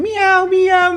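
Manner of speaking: high mock cat meows. Telling his girlfriend about the cat, high mock meowing.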